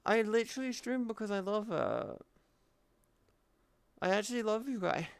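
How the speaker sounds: hammed-up hurt voice, pitched high; whiny mock hurt. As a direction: play the wounded little kid begging them to take it back. Whining at chat's teasing remark.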